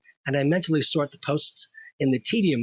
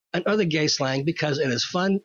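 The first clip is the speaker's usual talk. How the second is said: ordinary talk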